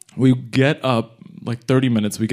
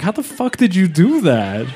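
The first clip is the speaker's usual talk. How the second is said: high-pitched incredulous question; retold exasperated amazement; baffled disbelief; 6 semitones higher than usual. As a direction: ask it as if utterly stumped. Baffled by wrinkled kippah.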